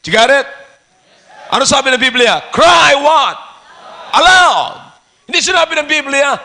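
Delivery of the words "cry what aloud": loud, raised preacher's shout; crying aloud. Shown as a demonstration. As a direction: call it out to the congregation.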